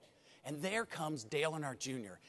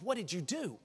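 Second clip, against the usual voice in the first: normal talking voice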